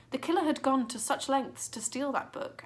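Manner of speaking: ordinary delivery, nothing marked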